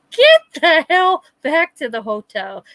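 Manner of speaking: high, slow mock yell; hammed-up exasperated demand; exasperated retold order. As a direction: play up ordering someone back, drawn out. Retold order to drunk brother.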